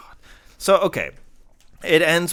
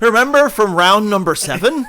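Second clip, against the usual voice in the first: laughing mock villain; 7 semitones higher, 7 dB louder than usual. Mocking villain quote laughing.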